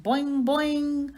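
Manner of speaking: high, loud twanging boing; frog twang. Acted. Frog Og answers hamster with a high twanging boing. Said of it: "Og twanged".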